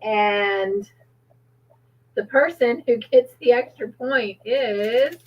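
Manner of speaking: normal talking voice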